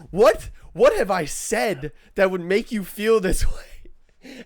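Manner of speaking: high, loud, slow affronted imitation; exasperated retold protest; mock indignant. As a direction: stretch it out in mock outrage. Retells an indignant challenge from relative.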